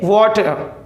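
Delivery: "वाटर" as WAW-ter, rounded vowel; correct water. Shown as a demonstration. Teacher repeating the right form, "वाटर" said as WAW-tuh. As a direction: say "वाटर" with "aw" in place of "aa". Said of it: "क्या बोलेंगे?".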